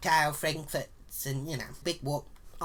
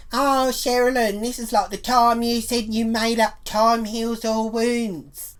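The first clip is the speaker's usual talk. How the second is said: mocking taunt; 4 semitones higher, 7 dB louder, slower than usual. Retold needling from Mary Ann.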